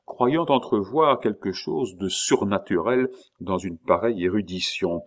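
plain reading voice

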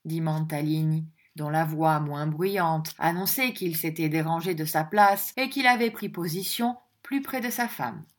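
ordinary delivery

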